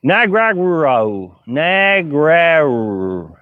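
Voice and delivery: Nagrarau sounded out very slowly, syllable by syllable; slow, hesitant try at saying Nagrarau; tentative pronunciation attempt. Shown as a demonstration. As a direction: carefully spell out Nagrarau by ear, unsure. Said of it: "I don't know how to pronounce your name".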